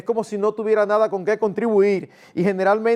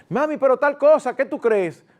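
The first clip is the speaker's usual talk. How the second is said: put-on child voice; 6 semitones higher, 4 dB louder than usual. Child asks mother for permission.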